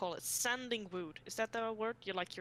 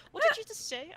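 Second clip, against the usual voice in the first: put-on girl's high questioning voice; 14 semitones higher, 7 dB louder than usual. Classmate questioning her.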